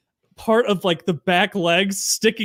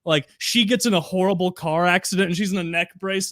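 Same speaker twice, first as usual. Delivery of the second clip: ordinary delivery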